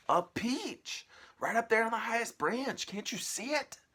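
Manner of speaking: high, loud, bossy aunt calling; shrill aunt voice. Acted. Aunt calling her sister to the tree, high and loud. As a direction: screech it out like a nasty aunt.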